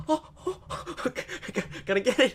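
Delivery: high, breathless mock excitement; excited squeal. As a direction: squeal like spotting a rare catch. Retold excited self at Pokemon.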